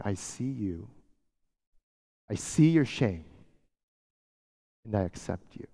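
soft, low, tender reassurance; almost a hush, full of acceptance; Jesus softly accepting the shamed woman. Acted. Jesus voiced again, softer.

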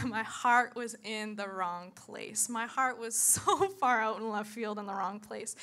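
normal talking voice

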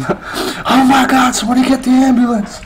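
hammed-up falsetto panic; mock panic. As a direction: wail it in comic alarm. Cop in the joke panics.

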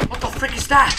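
high, loud startled question. Real, not acted. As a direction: blurt it out in alarm. Startled at something.

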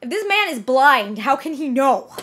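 ordinary talk, nothing marked

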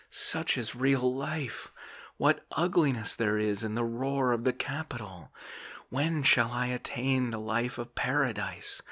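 pained yearning. A narrator's reading. Dreamer longing for fields and forests, pained yearning. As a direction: yearn aloud, a touch of pain. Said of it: "he sighed with a sense of pain".

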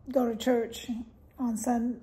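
normal talking voice

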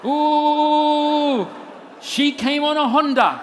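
loud, high ecstatic tongues parody; mock Pentecostal. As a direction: wail it out like over-the-top charismatic prayer. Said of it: "I didn't go all Pentecostal".

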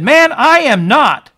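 high, loud, slow protest; loud slow denial. Acted. Preacher as Peter, loudly denying Jesus to a bystander. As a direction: drag out the denial in alarm.

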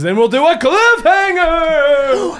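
loud, high, slow cliffhanger call; dramatic announcement. Real, not acted. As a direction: announce it like a TV hook.

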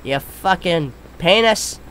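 ordinary delivery

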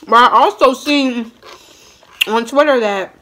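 words muffled by food; talking while chewing. Real, not acted. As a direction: mouth partly full of rice. Seen 2: Rice forked into mouth at 1.9, then talks with closed, working lips.

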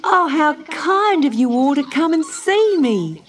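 put-on high, loud delighted little girl; little girl thrilled to see the animals; Dot crying out. A narrator's reading. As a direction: cry out with childlike delight. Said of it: "cried Dot".

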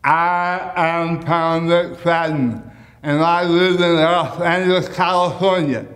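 said slowly with tongue stuck out; tongue sticking out. Shown as a demonstration. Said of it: "stick your tongue out and say your name".

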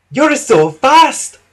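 slow, high, loud amazement; wheezed admiration. A narrator's reading. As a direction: stretch it out, impressed and pitched high. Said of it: "wheezed Renes".

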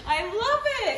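giggling through the words; laughing. Real, not acted. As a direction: laugh it out delighted. Laughing at worm socks.

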